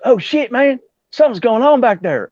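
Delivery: high-pitched imitation of his Georgia friend; whistling talker. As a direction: whistle through the words, sounding alarmed. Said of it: "he had this whistle when he talked".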